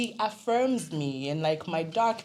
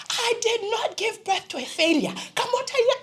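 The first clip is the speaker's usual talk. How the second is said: higher, firm, rising rebuke; mother's fierce refusal; 4 dB louder than usual. Retold or imitated. Mother rebuking her crying daughter, high insistent refusal. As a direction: refuse to let her child fail. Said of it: "The way my mother changed".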